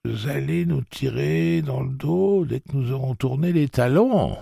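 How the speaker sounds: put-on high, quavering frightened old man; old man shaking with fear. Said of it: "dit Jeeves d'une voix chevrotante".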